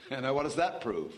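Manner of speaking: said through laughter; tickled, chuckling question; amused. Real, not acted. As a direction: still laughing as you ask it. Laughing at magic swami claims.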